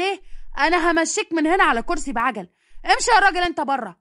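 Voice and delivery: a grandson bellowing at his mother's suitor; furious shouting. Acted. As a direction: throw him out with a roar. Hossam threatens the suitor.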